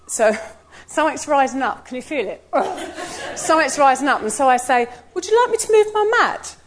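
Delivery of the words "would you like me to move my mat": high, sugary politeness covering irritation; sweet as pie while gritting through it; forced-polite offer to the lady; overly sweet offer. Retold or imitated. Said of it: "Please don't let the tone of voice".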